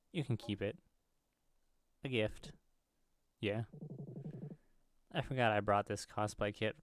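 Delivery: normal talking voice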